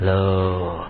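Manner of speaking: normal talking voice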